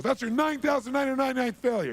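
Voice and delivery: high, loud sarcastic jab; mocking friend. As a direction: rub his failure in his face. Buddy mocks Edison's failures.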